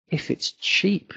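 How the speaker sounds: slow, dismissive tone on cheap; disapproving cheap. Shown as a demonstration. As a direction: say it like a put-down. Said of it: "That's a negative thing".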